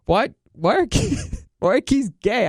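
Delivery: high, incredulous question broken by laughs; amused mock disbelief; baffled, laughing retort. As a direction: imitate yourself baffled, cracking up midway. Laughing, baffled retort in retold argument.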